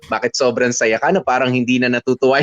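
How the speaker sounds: ordinary delivery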